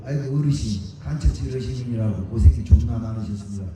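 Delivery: bow and scrape sarcastically; mock fawning deference. Speaker mocks people honouring an old spy, sarcastic fawning.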